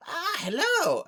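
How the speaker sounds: high, loud gnome greeting; eccentric gnome. Acted. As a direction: squeak out a startled, eccentric hello. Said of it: "and they go".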